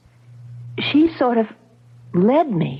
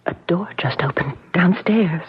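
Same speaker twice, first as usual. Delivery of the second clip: unvoiced, hushed warning; frightened whisper; 4 semitones lower than usual. She warns him of the door downstairs in a whisper. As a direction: whisper so nobody downstairs hears.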